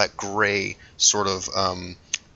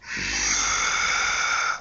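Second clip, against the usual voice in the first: breathy creature sigh; 13 semitones lower, 4 dB quieter than usual. Acted. Dog creature sighs before speaking.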